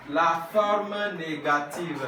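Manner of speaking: slow, stretched-out syllables; spaced out for repeating; slow model reading. Shown as a demonstration. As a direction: model it slowly for the class. Models French slowly for class to repeat.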